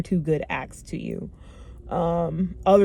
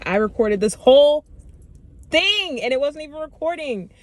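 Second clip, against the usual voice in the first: frustrated; 8 semitones higher, 4 dB louder than usual. Real, not acted. Venting that recording failed again.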